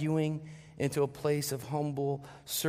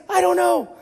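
high, loud comic shout; panicked yell back; 12 dB louder than usual. Retold or imitated. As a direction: holler it in mock exasperation. Dad yells back in panic.

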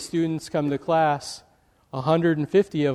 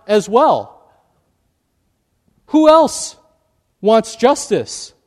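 stern rebuke; 6 semitones higher, 6 dB louder than usual. Retold or imitated. Professor rebuking the indignant students.

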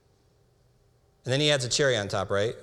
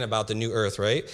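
ordinary delivery

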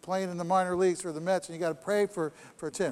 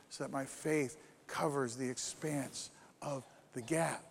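much softer, low and intimate; hushed prayer; 5 semitones lower, 9 dB quieter than usual. Acted. Preacher modelling a faith prayer for listeners, much softer. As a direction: drop to a near-murmur, as if praying alone.

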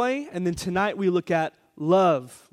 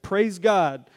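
normal talking voice

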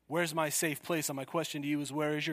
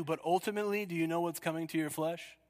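ordinary talk

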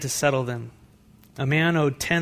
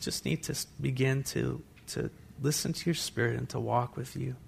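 closing prayer; 6 dB quieter than usual. Acted. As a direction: pray quietly over the room.

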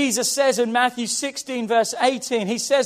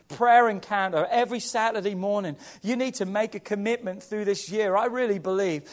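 ordinary talk

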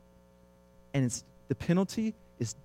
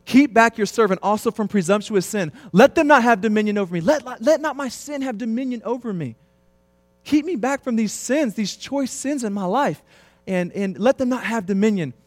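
higher, louder pleading prayer; fervent prayer; 8 semitones higher, 4 dB louder than usual. Acted. David praying to be kept from sin, fervent plea. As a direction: beg God urgently for deliverance.

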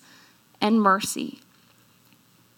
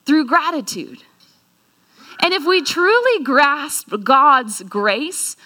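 ordinary talk, nothing marked

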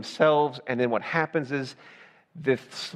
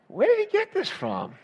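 much higher, put-on puzzled voice; mock-innocent wondering. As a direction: play innocent in a high, comic pitch. Mock-innocent parent hears son yelling.

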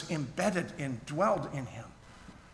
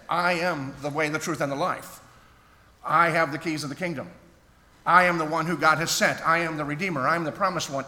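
louder, ringing pulpit proclamation, leaning on each "I"; proclaiming Jesus' claims; 6 dB louder than usual. Acted. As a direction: declare each "I am" like a sermon climax.

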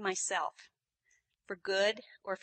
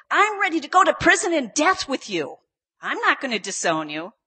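sure of himself; 6 semitones higher, 14 dB louder than usual. Retold or imitated. Peter vowing never to disown Jesus, loudly sure. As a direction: declare it loudly with total confidence. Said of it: "He's so sure of himself".

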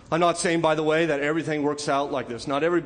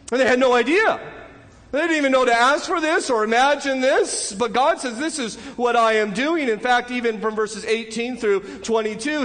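raised, higher-pitched sermon voice; fervent preaching; 8 semitones higher, 4 dB louder than usual. Acted. Preacher marvelling at God bringing a king, fervent. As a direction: preach it with rising excitement at God's plan.

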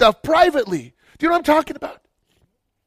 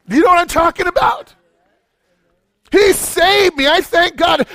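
loud, high preacher's shout; impassioned sermon shout; full-force preaching; 8 semitones higher, 3 dB louder than usual. Real, not acted. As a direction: preach it out at the top of the voice.

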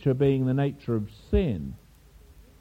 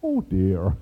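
put-on lightly done sweet Irish maiden accent, lower; a hint of a gentle Irish girl's accent; 7 semitones lower than usual. Irish waitress fretting over the teapot, mild sweet Irish accent. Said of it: "in a sweet Irish maiden accent".

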